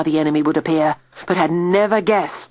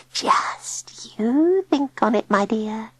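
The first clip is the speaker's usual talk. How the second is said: high, slow, breathy whisper; whispered witch; 6 semitones higher, slower than usual. A narrator's reading. Old witch whispering to her captive Peter. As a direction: hiss it out slowly like a crooning hag. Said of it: "she told him softly".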